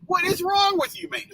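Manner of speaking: can't believe this guy; disbelieving rant at a careless driver; exasperated at driver. Real, not acted. Driver with window down in rain.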